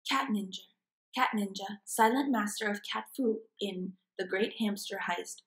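usual speaking voice

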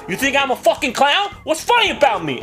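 high, pressing mobster challenge; Joe Pesci. Retold or imitated. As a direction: act out a touchy gangster demanding an answer. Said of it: "Joe Pesci, right?".

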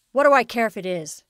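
loud angry retort. Acted. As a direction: snap it back loudly. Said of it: "retorted his father".

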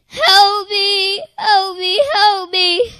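high, loud, tearful cry for help; begging through tears, voice up high; crying plea. Retold or imitated. Child begging in the dark tunnel, tearful cry.

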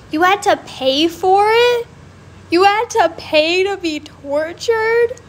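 shocked she paid for the dissection; outraged disbelief. Real, not acted. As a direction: sound appalled.

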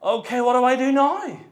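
high, loud mock panic; hammed-up alarm at the baby; panicked new parent. Preacher acting a new parent staring at the baby, mock panic. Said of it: "that kind of".

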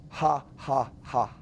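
low, spaced-out mock "ha, ha, ha"; scripted fake laugh. Shown as a demonstration. Coach dictating a sales script, deliberate fake laugh. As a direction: token laugh, syllable by syllable.

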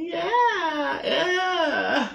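high, squealing yeah yeah; squealing delight. Real, not acted. Tasting syrup on bacon, high squeal of delight. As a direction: squeal with delight over the food.

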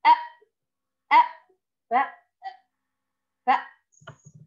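أ then ف then فأس blended, last word whispered; sounding out. Shown as a demonstration. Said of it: "أقول". (تهجئة كلمة فأس صوتًا صوتًا)

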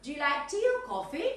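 "coffee" lifted high at the end of the question; rising intonation. Shown as a demonstration. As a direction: let the yes-no question climb up on "coffee". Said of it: "rise in intonation".

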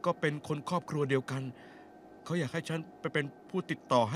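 halting delivery with repeated words; stammering report. Acted. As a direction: stutter at the start of phrases. Stammering messenger reports to boss.

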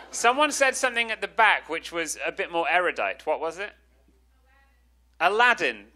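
ordinary talk

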